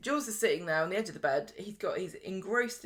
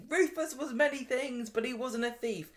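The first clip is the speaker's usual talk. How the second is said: high and mighty; 6 semitones higher than usual. Retold or imitated. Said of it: "gets all high and mighty".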